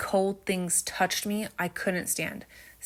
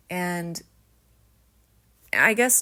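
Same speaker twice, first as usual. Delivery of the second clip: usual speaking voice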